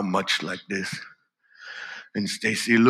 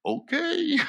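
squeaky okay. Acted. Timid boy agreeing to swim.

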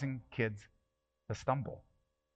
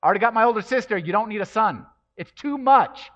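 high, loud, indignant imitation of the critics; loud mock voice of the people pressuring mom; harsh critics quoted; 8 semitones higher, 11 dB louder than usual. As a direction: throw their cruel words back, much louder and higher. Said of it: "they were being said to my mom".

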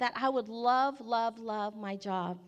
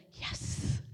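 whispered yes; 4 dB quieter than usual. Retold or imitated. Retelling their relieved eager answer.

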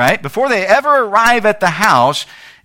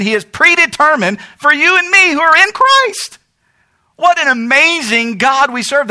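fervent preaching; 6 semitones higher than usual. Acted. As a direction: lift the church with it. Preaching with rising force.

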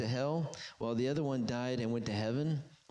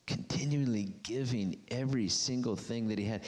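usual speaking voice

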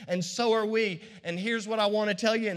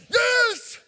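much higher and louder, a jubilant shout; triumphant yell; 14 semitones higher, 8 dB louder than usual. Retold or imitated. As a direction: burst out in victory at the finish line. Acted crossing the finish line.

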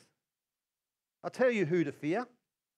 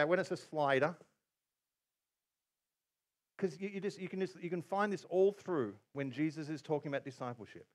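normal talking voice

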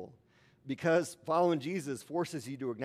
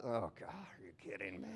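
eye-rolling exasperation; 4 semitones lower, 9 dB quieter than usual. Retold or imitated. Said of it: "Jesus just rolled his eyes".

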